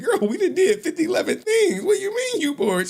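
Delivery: high, incredulous mock protest; exasperated dad. Dad answering his bored daughter, high mock exasperation. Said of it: "I'm like".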